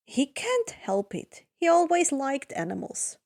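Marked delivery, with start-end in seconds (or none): none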